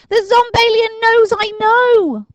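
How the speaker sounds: put-on high, loud, slow alarmed boy; panicking Dinking. A narrator's reading. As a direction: spell out the danger in horror.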